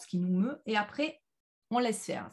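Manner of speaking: ordinary talk, nothing marked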